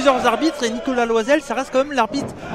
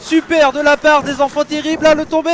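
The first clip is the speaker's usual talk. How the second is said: wrestling commentator; 5 semitones higher, 4 dB louder than usual. Real, not acted. Excited wrestling play-by-play.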